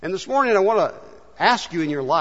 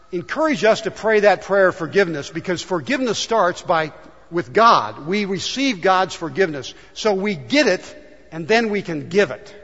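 ordinary talk, nothing marked

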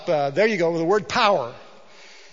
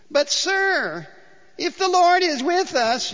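high whining plea; whiny Gideon; 10 semitones higher, slower than usual. Acted. Preacher voicing Gideon's whiny protest to the angel. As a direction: whine it like a sickening coward. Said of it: "you need to imagine a whiny, sickening kind of voice here".

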